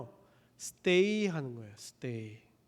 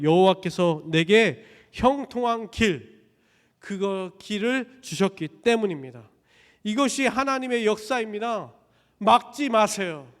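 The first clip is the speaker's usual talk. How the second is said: bold declaration; 4 semitones higher, 5 dB louder than usual. Acted. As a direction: stand firm and proclaim it. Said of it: "담대하게 얘기하는 거예요".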